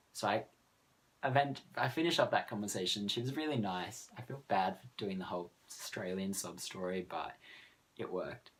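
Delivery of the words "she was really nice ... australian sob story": usual speaking voice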